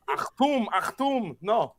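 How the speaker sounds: high mock-German call; mock German accent. Playing at angry German.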